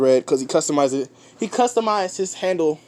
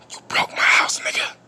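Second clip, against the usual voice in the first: whispered aside. Real, not acted. Muttering to the drowned in his house, whispered. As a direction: hush it under your breath. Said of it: "whisper to someone at home".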